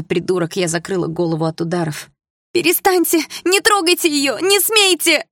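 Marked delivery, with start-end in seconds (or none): 2.5-5.3 very high, loud pleading scream; desperate shout. Acted. Girl begs the gang beating her friend: desperate scream. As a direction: scream at them to stop.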